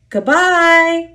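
high, loud sustained call; whistled call. A narrator's reading. Duck calls out as he leaves, whistle-like. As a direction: sing it out like an engine whistle. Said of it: "whistled Duck".

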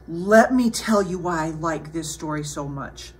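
ordinary delivery, nothing marked

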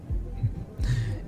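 very soft, airy laugh; quiet little chuckle; breathy chuckle. Acted. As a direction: laugh lightly under the breath. Amused after compliment.

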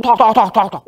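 loud, high, rapid "tak" gunshots; imitated gunfire. Comedian mimicking a magazine emptied at a bus driver, sharp gunfire imitation. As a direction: fire the syllables off like shots.

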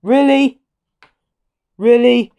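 high, loud disbelieving cry; incredulous really. Real, not acted. Disbelief at a bad reveal.

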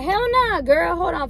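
high, sassy hell nah; sassy refusal. Retold or imitated. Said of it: "So I'm like".

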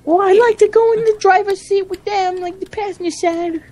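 chattering in high falsetto; imitating lady announcer. Player continuing as the game's lady, high-voiced impression. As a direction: keep up a put-on high lady's voice.

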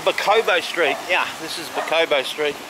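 ordinary delivery

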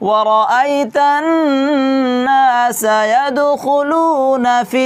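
very slow recitation, vowels stretched; Quran recitation. Shown as a demonstration. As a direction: chant the verse slowly as a model reading. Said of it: "নিল্লা কেন হয়ে গেল?". (ধীর সুরে তিলাওয়াত)

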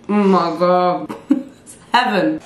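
slow, loud, thrilled food rapture; delighted gasp. Real, not acted. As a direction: savour it out loud with joy. First bite of favourite food.

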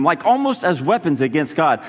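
ordinary delivery

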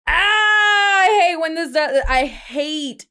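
high, slow, loud cry of frustration; overwhelmed frustrated shout; frustrated half-cry. Real, not acted. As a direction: let it burst out in a pained wail. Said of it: "I'm so heated and it's too early".

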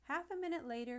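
plain reading voice

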